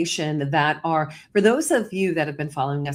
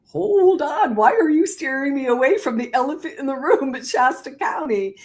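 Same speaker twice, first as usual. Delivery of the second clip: indignant disbelief; 8 semitones higher, 3 dB louder than usual. Retold or imitated. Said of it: "And he's just like".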